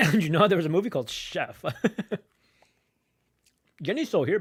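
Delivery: speaking through laughter; laughing. Real, not acted. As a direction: giggle through it. Laughing at the cat.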